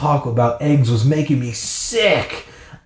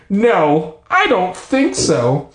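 queasy reluctance; 7 semitones higher, much slower than usual. Acted. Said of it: "my stomach did a flip-flop".

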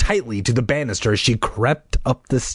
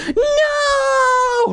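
very high acted scream; cry of protest; 3 dB louder than usual. Brother faced with a monster, terrified cry of protest. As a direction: shriek it out in fright. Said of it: "uttered a harsh cry of protest".

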